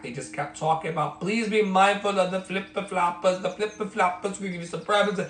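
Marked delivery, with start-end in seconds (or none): 1.2-5.2 put-on higher-pitched, lightly done Italian accent; hammy Italian cruise ship announcement; mock Italian accent. Ship crew warning guests about flip-flops, mock Italian accent. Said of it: "I don't do a well Italian accent".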